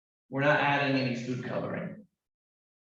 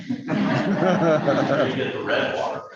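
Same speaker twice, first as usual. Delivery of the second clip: laughing through the words; chuckling while saying it; 4 semitones higher, 7 dB louder, much slower than usual. Real, not acted. Laughs at water mix-up.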